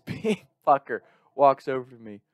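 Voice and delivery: ordinary talk, nothing marked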